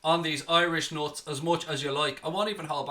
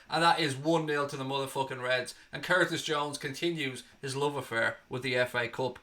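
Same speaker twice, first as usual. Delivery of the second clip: ordinary talk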